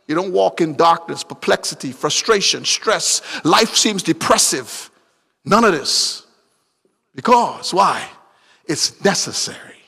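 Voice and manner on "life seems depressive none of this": usual speaking voice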